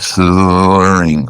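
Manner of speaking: "slurring" dragged out very slowly; imitated slurring. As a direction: stretch "slurring" like a drunk. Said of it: "he was slurring".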